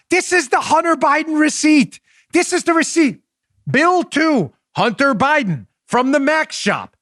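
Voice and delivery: high-pitched, emphatic rebuttal; exasperated insistence. Real, not acted. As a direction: hammer home the proof, exasperated. Exasperated display of the receipt.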